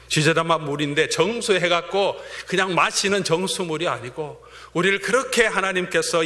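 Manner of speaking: ordinary talk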